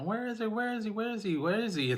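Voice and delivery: high-pitched imitation of mom calling for her son; a mother calling through the neighborhood, imitated high. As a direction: call out for the missing kid in a high, thin voice. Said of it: "She was out in the neighborhood yelling".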